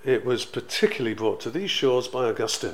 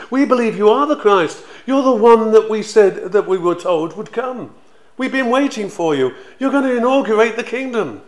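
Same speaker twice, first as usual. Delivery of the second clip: higher, louder, eager believers hailing their king; excited followers sure the kingdom is coming; eager disciples; 8 semitones higher, 8 dB louder than usual. Retold or imitated. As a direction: burst out with hopeful conviction. Disciples hailing the expected Messiah.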